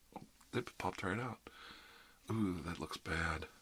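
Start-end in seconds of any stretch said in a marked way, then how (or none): none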